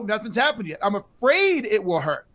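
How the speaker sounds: ordinary talk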